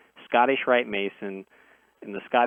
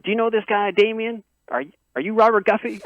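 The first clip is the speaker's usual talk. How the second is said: high-pitched imitation of a thick southern accent, louder; 10 semitones higher, 5 dB louder than usual. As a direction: mimic a skeptical southern good old boy in a southern accent. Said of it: "thick kinda, kinda southern accent".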